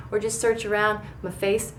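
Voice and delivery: ordinary talk, nothing marked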